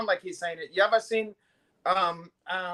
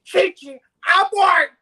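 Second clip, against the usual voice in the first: shouted, much higher and louder, barked order; mock shout; 11 semitones higher, 11 dB louder, slower than usual. Imitating a mob boss ordering abort, mock shout. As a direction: yell it like a furious boss. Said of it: "He goes".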